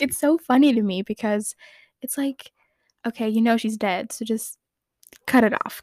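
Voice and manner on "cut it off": ordinary talk, nothing marked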